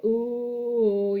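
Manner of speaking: "ooh" held long on one steady note; open-mouthed "ooh". Shown as a demonstration. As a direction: hold a long, rounded "ooh". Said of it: "you open your mouth".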